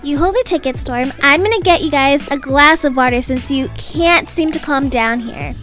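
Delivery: usual speaking voice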